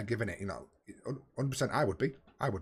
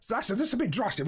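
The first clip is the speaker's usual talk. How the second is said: raised, playful voice; high put-on voice; 10 semitones higher than usual. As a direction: say it in a raised mock voice. Jokey aside while booking player.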